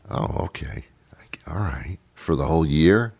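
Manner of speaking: hushed, hesitant go-along; subdued acceptance. Acted. Imagined listener accepting the accent excuse, hushed and doubtful. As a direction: drop to a near-whisper, half convinced.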